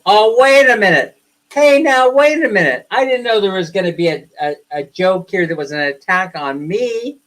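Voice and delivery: high, loud playful protest; mock indignation. Joke teller pretending the joke attacked him, mock indignant. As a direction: object loudly, as if wounded by the joke.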